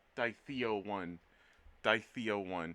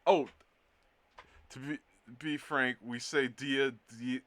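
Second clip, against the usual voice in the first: ordinary delivery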